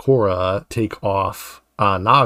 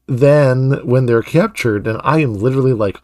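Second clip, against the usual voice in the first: ordinary talk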